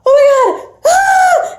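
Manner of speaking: high, loud shriek of shock; retold panicked scream. Companion seeing the UFO after a double take, panicked scream. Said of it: "And then I started screaming".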